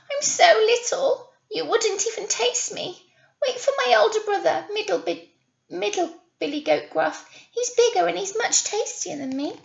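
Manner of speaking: high, quick little goat voice; tiny goat talking his way out; little goat pleading. A narrator's reading. Baby goat sending the troll to his brother, high and quick. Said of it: "he squeaked".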